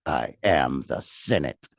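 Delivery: low, slow sinister emperor voice; low menacing villain line; Palpatine impression. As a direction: growl it like a Sith lord. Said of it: "who talks like that in Star Wars?".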